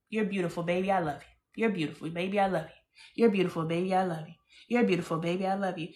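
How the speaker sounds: quiet, repeated soothing sweet talk; soft tender reassurance. Retold or imitated. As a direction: coo it to your husband again and again. Voicing her own sweet talk to husband.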